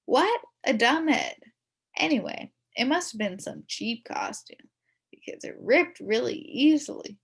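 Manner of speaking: usual speaking voice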